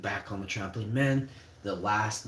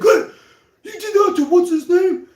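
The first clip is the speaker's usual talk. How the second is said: much higher, much louder taunting challenge; threatening bullies; 15 dB louder than usual. Retold or imitated. Four older guys confronting the boy, loud taunting challenge. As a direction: call him out like a thug. Bullies ambush him at tracks.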